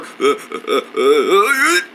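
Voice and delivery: halting "uh" gasps rising in pitch; pretend sneeze build-up. Wrinklehead asking Ma for remedies, mock sneeze coming on. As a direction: stammer up towards a fake sneeze.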